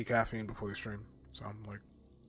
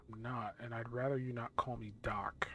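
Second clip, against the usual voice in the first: whispered; 3 semitones lower, 5 dB quieter than usual. Acted. Doctor answers the patient.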